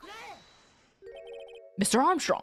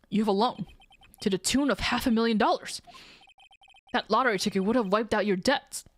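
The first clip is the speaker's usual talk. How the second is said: ordinary talk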